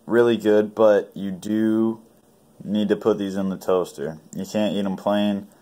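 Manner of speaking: eating while speaking; talking while chewing. Real, not acted. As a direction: mouth partly full of pastry. Seen 2: Bites pop-tart near 0.2; holds piece, mouth working while talking.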